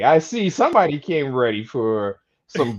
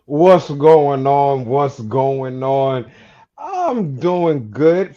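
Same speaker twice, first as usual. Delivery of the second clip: slow, drawn-out greeting; slow drawl; 3 semitones lower, much slower than usual. Acted. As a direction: stretch each word out slowly.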